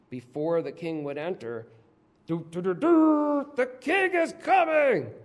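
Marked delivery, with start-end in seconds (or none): 2.3-3.5 loud, high "doo doo" fanfare sung like a herald's trumpet; mock trumpet fanfare. Herald announcing the king's arrival. 3.5-5.1 high, loud herald call announcing the king; herald's announcement. Retold or imitated. Herald announcing the king's arrival.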